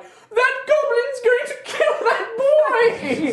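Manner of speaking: shrieking townswoman, very high and loud; drawn-out hysterical accusation; alarmed woman. Acted. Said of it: "a woman is like".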